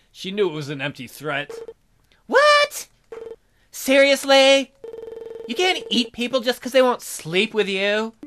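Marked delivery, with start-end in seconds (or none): 2.3-8.1 high, loud disbelief; indignant [name]. Acted. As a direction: scoff at the bear's empty threat.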